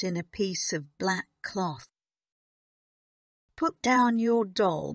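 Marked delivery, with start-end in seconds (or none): 3.6-4.9 higher, firm command; firm headmistress's order; stern order. A narrator's reading. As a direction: order the child sharply, louder. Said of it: "Miss Minchin ordered".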